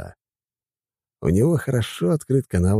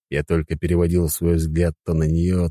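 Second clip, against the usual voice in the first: ordinary delivery, nothing marked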